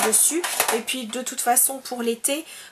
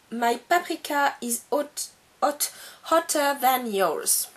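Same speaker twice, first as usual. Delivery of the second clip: English with a French accent; halting English in a French accent, word by word. Real, not acted. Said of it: "mon accent anglais tue".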